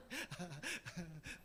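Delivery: low, soft laugh; soft chuckle. Real, not acted. Preacher over mixed-up verse, soft chuckle. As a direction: chuckle quietly at the muddle.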